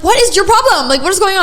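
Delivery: retold outburst of disbelief; indignant confrontation. Headphone wearer confronting the woman who grabbed her, indignant.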